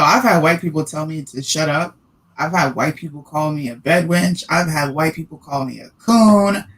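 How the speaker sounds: usual speaking voice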